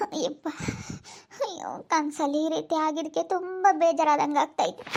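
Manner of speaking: upset, with sobbing breaths; weepy, wounded complaint; tearful. Acted. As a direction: lament through acted tears. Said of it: "ಅಳ್ಬೇಡ, ಸುಮ್ನಿರು".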